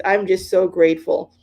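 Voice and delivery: usual speaking voice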